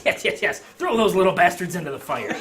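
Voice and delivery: loud, laughing urging; gleeful barker. Acted. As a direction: egg them on with glee.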